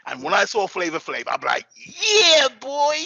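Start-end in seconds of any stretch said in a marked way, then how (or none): 1.8-3.0 put-on high, loud hype-man shout of yeah boy. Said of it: "See, I just did it again. I just took him again".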